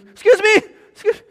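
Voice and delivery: high, loud calling out; urgent call. Pretend. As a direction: flag down the attendant in panic. Passenger calling the flight attendant.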